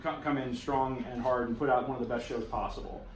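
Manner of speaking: normal talking voice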